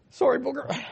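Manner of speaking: emotional apology. Real, not acted. Preacher apologizing to a listener for tearing up, emotional. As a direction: still choked, say sorry gently. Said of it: "you get a little, little emotional".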